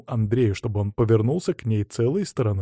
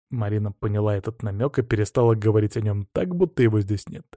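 plain reading voice

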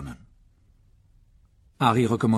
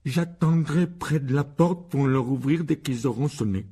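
slow, higher recited voice; dutiful spoiled son; 5 semitones higher, 4 dB louder, slower than usual. Acted. Son reciting his door duty to his parents, laboured and dutiful. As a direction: recite your part slowly and dutifully.

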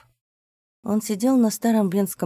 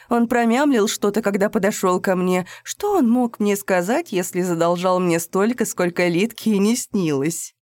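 contemptuous; 3 semitones higher, 6 dB louder than usual. A narrator's reading. Mocking the debtor, loud.

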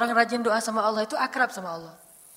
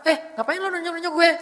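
higher, confrontational voice; 7 semitones higher, 4 dB louder than usual. Retold or imitated.

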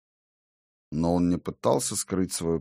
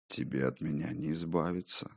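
mysterious voice; 3 semitones lower, 10 dB quieter than usual. A narrator's reading. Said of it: "произнёс голос".

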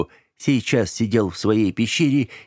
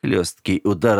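usual speaking voice

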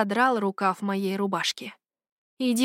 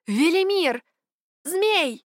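calling to the sky; 8 semitones higher, slower than usual. A narrator's reading. Heroine calling the dragon from the sky. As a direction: cry out to the dragon far above. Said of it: "закричала в небо".

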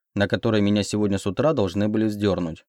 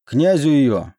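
loud, raised-pitch command; booming guard's bark, pitched up; thundering order; 5 semitones higher, 5 dB louder than usual. A narrator's reading. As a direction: thunder it out right by the ear. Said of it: "прогремел вопрос над ухом".

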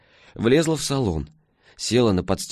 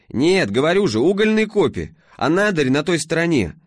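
перекрикивая мотор; 8 semitones higher, 4 dB louder than usual. A narrator's reading. Алёна в машине, громко.

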